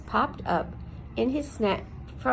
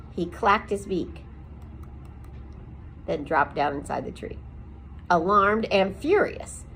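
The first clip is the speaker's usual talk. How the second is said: ordinary delivery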